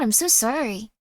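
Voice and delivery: usual speaking voice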